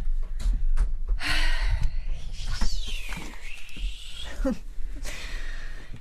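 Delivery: long tired sigh; weary sigh. Real, not acted. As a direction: breathe out in resignation. Co-host dreads talking to caller.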